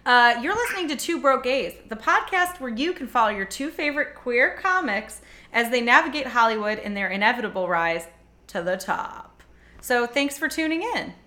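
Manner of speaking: upbeat show-opening announcer; podcast host intro. Acted. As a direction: welcome listeners like a host.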